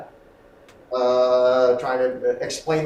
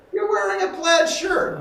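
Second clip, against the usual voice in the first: much higher and louder, full of reaction; charged repetition; 6 dB louder than usual. Shown as a demonstration. Acting teacher showing the right repetition, high and loud. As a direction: throw it back with real feeling, way up high. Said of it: "You gotta go".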